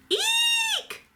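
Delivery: very high startled bird shriek; shrill shriek. A narrator's reading. Mama Bird seeing feet on the nest edge, shrieking. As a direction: shriek in alarm. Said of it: "she cried".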